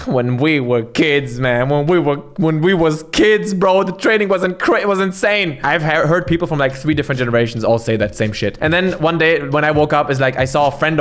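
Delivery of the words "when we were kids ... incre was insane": slow, drawn-out boasting master; lightly voiced Shaolin master reminiscing; mock old master. Streamer mocking Shaolin masters' hard-training stories, slow and boastful. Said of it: "Every master that taught me in Shaolin is like".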